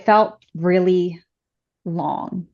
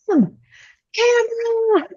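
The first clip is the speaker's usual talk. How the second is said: held-back yell, pitched way up; imitated scream; slower than usual. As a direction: imitate calling frantically down a hallway. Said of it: "he's screaming down the hallway".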